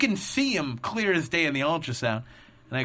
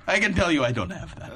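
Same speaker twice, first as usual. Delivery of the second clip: lightly imitated Russian accent with laughter; a hint of a Russian tech, amused retelling; Boris; 3 semitones higher than usual. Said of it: "as Borisy as possible".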